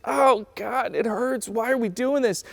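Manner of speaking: high-pitched wail of complaint; hammed-up pleading to God; mock anguished whine. People caught up in pain cry to God, mock whining.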